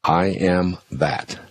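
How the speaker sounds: very slow, drawn-out mantra. Shown as a demonstration. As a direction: stretch the words out calmly. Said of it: "Say it over and over as your inner mantra".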